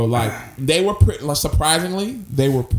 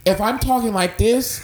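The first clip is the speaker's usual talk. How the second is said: raised pitch but no shouting; talking without emphasis; 6 semitones higher than usual. Shown as a demonstration. Showing his friend talk without claps, unemphatic. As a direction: say it without punching the words. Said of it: "Yeah, it's more".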